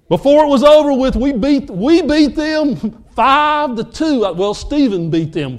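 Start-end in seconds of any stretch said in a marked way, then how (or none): 0.1-4.2 triumphant. Real, not acted. Triumphant result.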